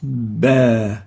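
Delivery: با said with an open a; voweled ba. Shown as a demonstration. A teacher demonstrating a moving ba to students. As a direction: release با cleanly onto the a. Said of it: "حرفا آخرا متحركا".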